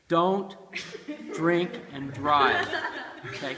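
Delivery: loud, slow, each word pressed down; a firm public-service warning, slow and loud; earnest warning. Real, not acted. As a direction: lay down the law to the viewers. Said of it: "If you're watching this video".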